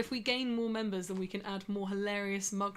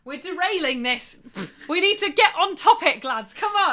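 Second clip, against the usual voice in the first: loud, high rallying call; calling to order; 6 semitones higher, 10 dB louder than usual. Real, not acted. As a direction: call everyone back to order, loudly. Host calls the chat back to topic.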